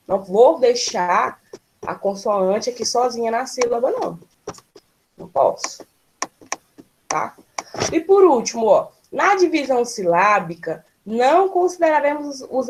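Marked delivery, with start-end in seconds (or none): 5.2-7.4 whispered aside. Real, not acted.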